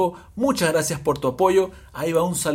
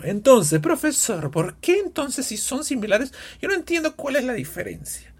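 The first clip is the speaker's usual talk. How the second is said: high, pleading mock student voice, faster at the end; confused student; 10 semitones higher than usual. Teacher acts a confused student asking why, high mock voice. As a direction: whine it like a lost pupil.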